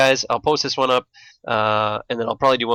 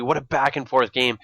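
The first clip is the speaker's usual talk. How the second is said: normal talking voice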